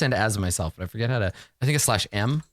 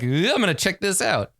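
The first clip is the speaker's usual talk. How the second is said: eager curiosity; 8 semitones higher than usual. Acted. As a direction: act out a curious adventurer diving in. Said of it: "you're about to be like".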